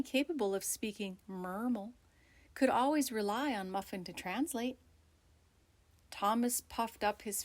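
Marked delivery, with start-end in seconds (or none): none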